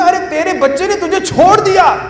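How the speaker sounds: high-pitched, incredulous retold question; acted disbelief. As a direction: act out an indignant protest to a mother. Acted protest to abandoned mother.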